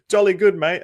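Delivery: mock British accent. Americans greeting an Australian as if British, mock British accent. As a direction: put on a jokey British accent. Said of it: "that's a British thing".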